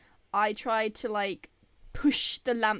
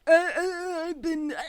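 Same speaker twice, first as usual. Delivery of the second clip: pained whimpering; 7 semitones higher, 6 dB louder, slower than usual. Retold or imitated. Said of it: "and I was like".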